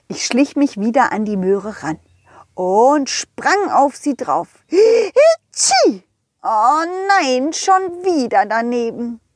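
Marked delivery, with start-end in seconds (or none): 4.7-6.0 high sneeze, a bit louder; a big sneeze from a tiny snowman. Acted. (lautes, hohes Niesen)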